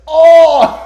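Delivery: high, very loud wail; hammed-up drawn-out cry; mock scream. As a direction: imitate someone screaming as they vanish. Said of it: "And if they're screaming".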